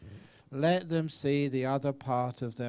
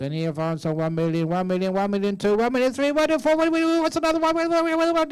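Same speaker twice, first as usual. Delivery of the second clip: much higher and louder, rattling off bids ever faster; frenzied auctioneer; 10 semitones higher, 9 dB louder, faster than usual. Retold or imitated. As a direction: whip the room into a bidding frenzy. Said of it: "the way that they do these auctions".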